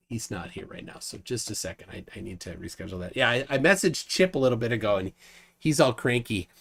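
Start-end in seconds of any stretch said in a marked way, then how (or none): 0.1-3.2 low, very soft busy mumble; half to yourself, voice dropped right down; quiet aside. Real, not acted. Host stalling while rescheduling, quiet and low.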